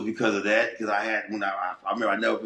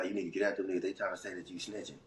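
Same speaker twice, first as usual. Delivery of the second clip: hushed warning; 6 semitones lower, 11 dB quieter, faster than usual. Retold or imitated. Homie warns him in the cell.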